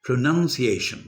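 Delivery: "প্রনাউন্সিয়েশন" said as "pro-NUN-ciation"; correct pronunciation. Shown as a demonstration. Teacher repeating the right form, "প্রনাউন্সিয়েশন" with "nun" inside. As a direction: put "nun" in the second syllable of "প্রনাউন্সিয়েশন". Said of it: "প্রনাউন্সিয়েশন বলে কোনো শব্দ নেই".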